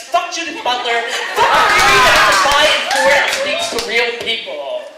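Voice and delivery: loud, defiant spoken-word belting; belted out. Retold or imitated. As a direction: belt it out with fury. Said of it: "she belts out".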